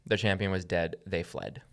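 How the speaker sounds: ordinary delivery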